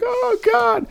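high, frightened groan; hammed-up gamer dread at the door; mock dread. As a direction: squeak it out in retold horror. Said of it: "I'm like".

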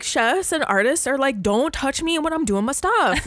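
a precious artist shooing people away; mock artist diva. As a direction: artist telling people not to touch her. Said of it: "I was like".

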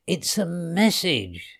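slow, savoured delight; understated storybook glee, drawn out; gleeful exclamation. A narrator's reading. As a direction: announce a discovery with relish. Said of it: "she shouted in glee".

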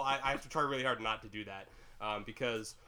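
normal talking voice

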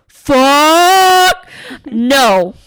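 loud, high, drawn-out first word; horrified refusal. Retold or imitated. Niece reacting to a graveyard of the dead, loud horrified refusal. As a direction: stretch it out in dread, then a firm no.

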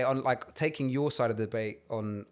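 normal talking voice

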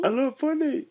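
very high, loud hello; high phone call. Acted. Boy on the phone.

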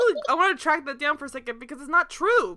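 ordinary talk, nothing marked

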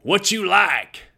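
thick Texas drawl, high and drawn out; Coach Tatum. Retold or imitated. Coach walking in on his player, thick Texas drawl. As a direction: holler it slowly in a Texan twang. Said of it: "He had that Texas drawl".

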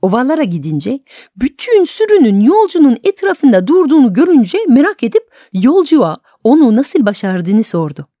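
plain reading voice

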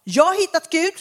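mock enthusiastic seeker; lightly voiced seeker; imitating seekers. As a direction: play the confident new age believer. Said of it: "Vem kan säga så idag".

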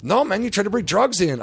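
high imitated voice of the objecting guard; mock whiny refusal. Guard refuses to return the ticket, high mock protest. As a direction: mimic a guard stubbornly objecting.

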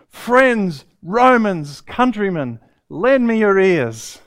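booming, high theatrical proclamation; Shakespearean declaiming. Retold or imitated. Hiker on the saddle declaiming Shakespeare to the hills, booming. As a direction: declaim it to the whole valley like a Shakespearean actor. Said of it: "burst out in Shakespearean splendour".

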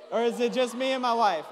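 amused aside to the crowd; laughing joke. Real, not acted. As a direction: laugh at your own joke. Laughing joke about hometown.

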